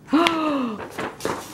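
high surprised gasp; sudden delighted intake of breath; sharp gasp. Real, not acted. Sees the card she wanted.

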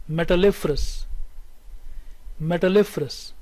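"Metalliferous" with main stress on "lif", lighter on "Met"; primary and secondary stress. Shown as a demonstration. Teacher shows both stresses to students, strongest on the third syllable. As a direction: model "Metalliferous", punching the "lif". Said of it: "Say with me".

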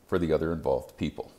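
usual speaking voice

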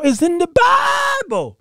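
high, slow emphatic shout; shouting it's in the Bible. Real, not acted.

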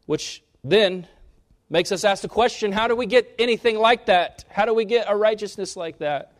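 loud and high, building up; rising preaching. Real, not acted. Preacher to congregation asking how, loud and high. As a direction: drive the question home.

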